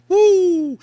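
loud high whoop of amazement; mock-amazed holler; awed woo. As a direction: whoop at the sight. Onlooker marvels at train.

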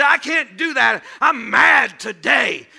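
slow, loud sulky complaint; loud, slow mock-mad whine; mock grumpy. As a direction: drag it out like a pouting churchgoer. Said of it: "You say".

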